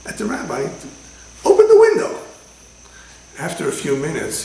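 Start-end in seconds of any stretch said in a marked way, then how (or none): 1.4-2.2 much higher, far louder shrill yell; yelling demand. Retold or imitated. Smoker yelling at the rabbi, very high and loud. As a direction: scream the order at the rabbi. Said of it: "And he's yelling".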